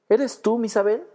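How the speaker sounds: high, eager disbelieving question; excited exclamation. A narrator's reading. Brother recognizing his lost sister, high excited question. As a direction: ask it in joyful disbelief. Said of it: "exclamó de pronto".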